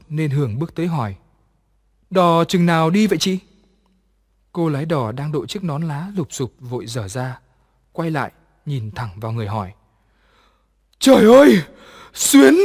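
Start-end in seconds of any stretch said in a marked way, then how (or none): 2.1-3.4 louder, higher anxious ask; impatient girl asks when the boat leaves; impatient question. A narrator's reading. 11.0-12.5 high, loud shriek of fright; girl screams on seeing the dead friend; terrified cry. A narrator's reading. Girl sees the ghost.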